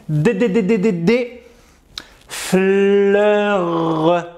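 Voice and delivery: slow syllable-by-syllable reading, stuttering on first sounds; halting reading. Pretend. Student sounding out a French sentence, halting and slow. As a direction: read like a struggling learner, repeating each starting sound. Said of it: "je te laisse les lire".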